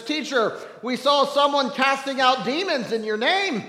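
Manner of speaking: lightly whiny disciple reporting a grievance; whining disciple. Retold or imitated. Disciple John complains to Jesus, lightly whining. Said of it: "I know the disciples whining".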